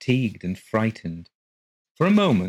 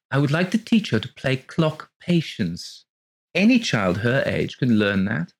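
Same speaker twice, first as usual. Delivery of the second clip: neighbour; 5 semitones higher than usual. A narrator's reading. Neighbour voiced.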